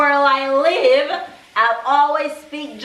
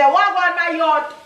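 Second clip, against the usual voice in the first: bright Jamaican patois hello, higher; Jamaican greeting; 6 semitones higher than usual. Shown as a demonstration. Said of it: "when you see Jamaican people, say".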